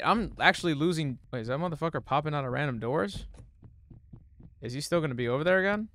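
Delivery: ordinary talk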